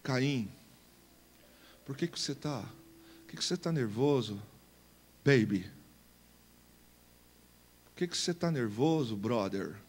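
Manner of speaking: God speaking gently and softly to Cain; soft gentle voice. Acted. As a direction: coax him softly, like a calm friend. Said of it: "Deus veio com a voz suave".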